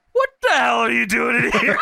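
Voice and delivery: high mock shout with laughter in it; imitated hollering. Imitating a wrestler confronting the interviewer, laughing mock shout. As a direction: holler it, hammed-up and amused.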